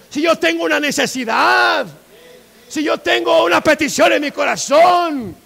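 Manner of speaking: loud, high, pleading protest; impassioned shouted insistence; crying out. Acted. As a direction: plead your need at the top of your voice. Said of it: "clamó".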